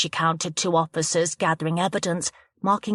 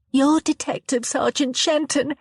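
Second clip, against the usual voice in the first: cried out; 9 semitones higher, 3 dB louder than usual. Acted. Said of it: "Chloe cried out".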